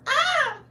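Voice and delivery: falsetto shriek mimicking a panicked man; imitated high-pitched scream. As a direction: scream way up high, poking fun. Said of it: "all you can hear him saying is".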